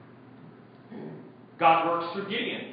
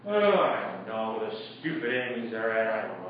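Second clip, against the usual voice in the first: mopey defeated grumble; 10 semitones lower, 4 dB quieter than usual. Acted. Preacher acting a defeated man.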